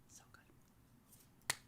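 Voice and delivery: unvoiced whisper of fond longing; dreamy whisper. Real, not acted. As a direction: sigh it out as a whispered memory. Remembering Israel's best pita.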